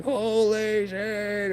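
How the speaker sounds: high, drawn-out startled exclamation; slow, high "holy shit" at the sight of a skunk; startled cry. Retold or imitated. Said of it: "we're like".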